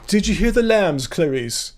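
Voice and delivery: a hint of Anthony Hopkins as Lecter; Hannibal Lecter accent. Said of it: "The Hannibal Lecter accent?".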